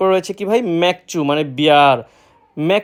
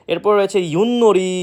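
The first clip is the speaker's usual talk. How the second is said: normal talking voice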